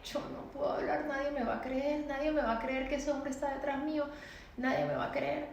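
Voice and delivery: put-on a scared child's silent prayer; fearful inner plea. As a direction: plead under the breath, sure no one will listen. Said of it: "Yo decía".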